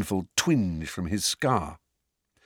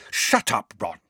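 angry. A narrator's reading. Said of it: "said Hermione angrily".

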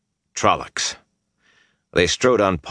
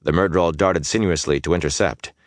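plain reading voice

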